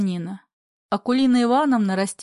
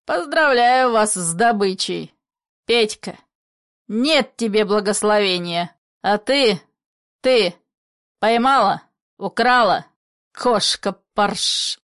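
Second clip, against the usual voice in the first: loud, spiteful accusation, building; a father cursing the girl who stole his son; 4 dB louder than usual. Acted. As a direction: spit the words at her. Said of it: "Злобно".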